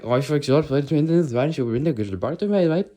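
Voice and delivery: high, loud, garbled mock Scottish; Scottish accent. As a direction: babble unintelligibly in a hammed-up Scottish accent. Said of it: "'cause Scottish people, when they speak English".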